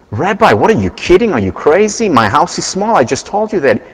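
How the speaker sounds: hammed-up villager asking whether the rabbi is crazy; incredulous protest. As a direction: villager told to bring the cow in, protests in disbelief. Villager protests bringing cow inside.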